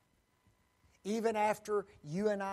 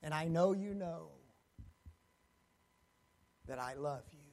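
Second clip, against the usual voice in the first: softer, lower tender vow; quiet confession; 3 semitones lower, 4 dB quieter than usual. Acted. Peter telling the Lord softly of his love. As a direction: drop to a hushed admission.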